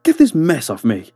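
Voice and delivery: high-pitched frantic cry; screaming. A narrator's reading. Trapped man struggles under the mass of flowers, screaming. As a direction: cry out while struggling to get free. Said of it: "he screamed".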